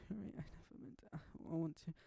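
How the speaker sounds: high, tearful and breaking; crying. Acted. Monika pleading with her ex-girlfriend, crying. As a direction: plead through tears. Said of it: "Monika cried as she said this".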